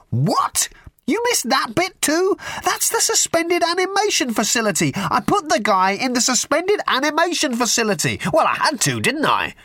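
very high, frantic squeal. A narrator's reading. Ford frantically explaining the ice to Arthur, very high. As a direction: spill it out in a high frenzy. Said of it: "screamed Ford".

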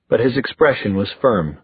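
ordinary delivery

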